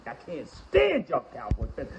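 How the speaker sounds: usual speaking voice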